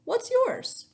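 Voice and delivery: high, loud eager ask; bright question. Acted. Girl asks name cheerfully.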